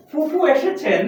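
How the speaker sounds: "ফ" as f, lip against teeth; mispronounced ph. Shown as a demonstration. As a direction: mimic the common slip fufu in "ফুফু". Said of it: "আমরা অনেকে বলি".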